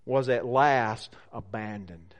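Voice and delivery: low, ominous quote with weighty pauses. Acted. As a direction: let the words fall slowly and ominously. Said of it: "with this ominous phrase".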